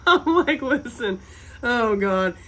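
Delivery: high squeal through laughter; laughing. Real, not acted. Recalling Nigel, breaking into loud laughter. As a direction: burst out laughing mid-line.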